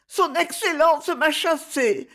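put-on high, slow child's voice; a little boy stammering through tears. A narrator's reading. Boy telling Myrto he was sent away, stammering. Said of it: "balbutia-t-il".

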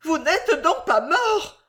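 high, drawn-out anxious cry; nervous lady crying out; nervous exclamation. A narrator's reading. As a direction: ask it high and slow, overwrought. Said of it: "s'écria la nerveuse personne".

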